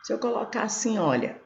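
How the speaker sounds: ordinary delivery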